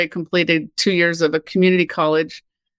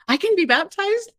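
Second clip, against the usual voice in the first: shocked disbelief; 12 semitones higher than usual. Retold or imitated. Shocked at being asked.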